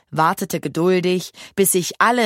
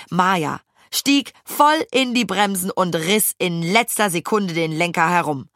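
usual speaking voice

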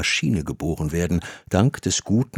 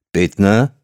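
louder, questioning call of a surname; deep voice calling; 6 dB louder than usual. A narrator's reading. Director summons his visitor from behind a door, calling out in a deep voice. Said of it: "rief eine tiefe Stimme".

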